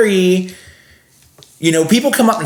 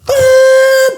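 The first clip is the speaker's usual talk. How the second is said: imitated whistle blast; 8 dB louder than usual. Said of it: "blew my whistle as loud as I could".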